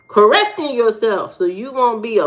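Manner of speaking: ordinary talk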